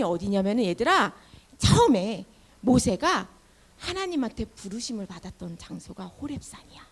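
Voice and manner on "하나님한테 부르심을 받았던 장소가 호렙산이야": whisper. Real, not acted. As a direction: whisper it like a secret. Secret aside to children.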